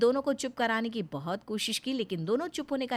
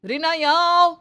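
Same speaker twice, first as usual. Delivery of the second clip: loud, high summons; shouting; 8 semitones higher, 12 dB louder than usual. Acted. Nitin shouts for his wife to come, even louder. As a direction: shout Reena, यहाँ आओ as an order. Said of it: "ये क्यों इतनी जोर-जोर से चिल्ला रहे हो".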